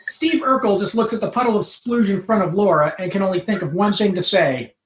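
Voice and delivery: ordinary talk, nothing marked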